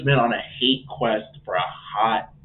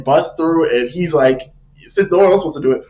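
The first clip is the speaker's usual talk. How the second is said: ordinary delivery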